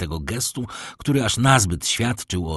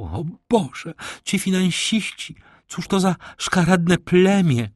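indignant outburst; 9 semitones higher than usual. A narrator's reading. Baroness scorning financiers.

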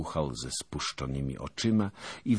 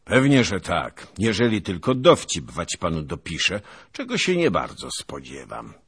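put-on raised, booming old nobleman's voice; pompous veteran holding forth at dawn; boastful old noble; 4 semitones higher, 8 dB louder than usual. A narrator's reading. Zagłoba boasting to his fellow knights, booming and pompous.

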